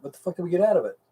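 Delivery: ordinary talk, nothing marked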